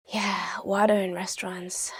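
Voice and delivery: unvoiced whispered aside. Real, not acted. Teacher whispers an aside to learners, whispering. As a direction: drop to a whisper for a side note.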